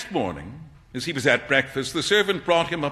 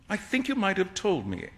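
sulky; 4 semitones higher, 7 dB quieter than usual. Acted.

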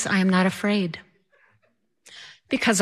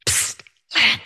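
unvoiced, urgent whispered call; stage whisper; 8 semitones higher than usual. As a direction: whisper sharply to get her attention. Husband hissing through the curtain.

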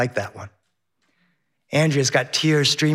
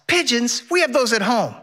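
higher, lightly voiced whiny kid; child whining; 7 semitones higher than usual. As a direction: whine like a let-down little boy. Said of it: "Henry whines".

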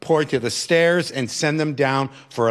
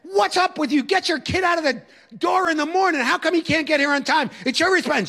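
high, shouted scolding of a parent; retold shout at a student's mother; tough guy yelling; 12 semitones higher than usual. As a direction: bark it out like a strict teacher. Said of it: "I'm yelling at her like the tough guy teacher".

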